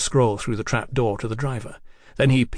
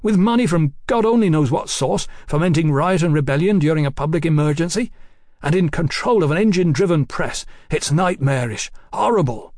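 agitated, higher-pitched alarm building; horrified outburst; 4 semitones higher, 3 dB louder than usual. Acted. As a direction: pour out mounting horror. Mallory breaks in, horrified.

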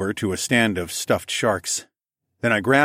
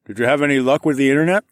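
mouth full; 6 semitones higher, 5 dB louder, faster than usual. A narrator's reading. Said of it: "through a mouthful of pasta".

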